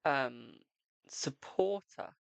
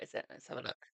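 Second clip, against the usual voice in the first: whispered aside; 8 dB quieter, faster than usual. Real, not acted. Whispered aside while checking cards.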